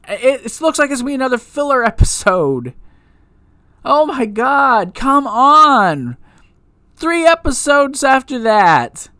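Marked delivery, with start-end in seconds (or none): 3.8-9.1 exasperated. Real, not acted. Exasperated outburst about filler.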